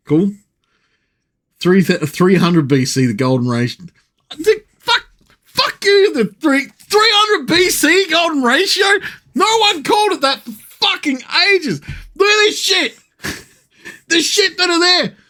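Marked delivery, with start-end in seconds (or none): 4.3-15.2 furious high-pitched rant; outraged rant. Real, not acted. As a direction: yell at the screen in disbelief. Ranting at the presentation.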